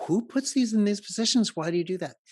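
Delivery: high, baffled inner question; puzzled wondering. Retold or imitated. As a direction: wonder aloud, bewildered. Puzzled worker thinking at mannequins.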